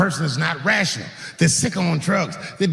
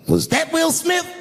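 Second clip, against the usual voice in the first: very high, needling Chris Rock delivery; Chris Rock impression; 13 semitones higher than usual. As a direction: tease the crowd. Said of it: "looks at the crowd and goes".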